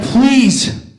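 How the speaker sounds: loud, hammed-up exasperated rant; mock exasperation. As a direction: vent at the caller in mock outrage. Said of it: "And I'm like".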